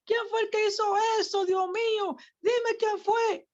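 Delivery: shrill-pitched cry of a furious king, slowing on the last plea; King David outraged. Acted. King David to the prophet Natán, demanding to know the culprit, outraged high voice. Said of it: "Y dice David".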